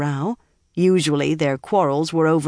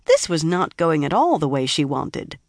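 ordinary delivery

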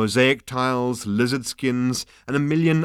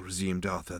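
plain reading voice

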